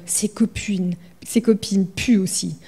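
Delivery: soft unvoiced whisper. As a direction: whisper it fearfully. Victor scared of the spider.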